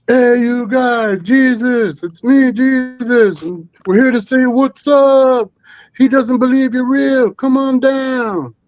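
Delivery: high, slow called-out voice; lofty slow voice calling into the trees; calling out as Jesus. Pretend. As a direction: hail the woods from afar like a holy visitor. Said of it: "I yell out to them".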